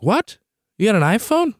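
high-pitched disbelieving question; astonished double-take, acted out; retold astonishment. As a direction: react as if stunned by the news. Retelling surprise at mom's iPhone.

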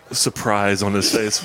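usual speaking voice

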